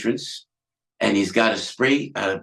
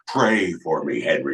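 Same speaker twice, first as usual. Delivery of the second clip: a lightly voiced Nixon, low; Nixon impression; 8 semitones lower than usual. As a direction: plead as Nixon, sinking low. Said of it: "and Nixon".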